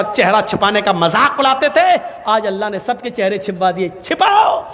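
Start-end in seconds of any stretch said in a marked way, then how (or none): 4.0-4.6 high, shouted order; sudden high-pitched imperative; mock command. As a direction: call it out like a barked command. Mock command to cover faces.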